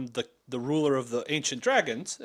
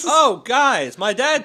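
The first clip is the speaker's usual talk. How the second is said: loud, higher call to the group; excited; 8 semitones higher, 9 dB louder, much slower than usual. Real, not acted. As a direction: blurt it out eagerly. Excited player remembers rumor.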